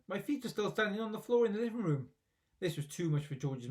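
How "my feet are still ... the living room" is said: a hint of a high gloating old woman; lightly cackling grandma. A narrator's reading. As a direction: gloat it like a mischievous granny.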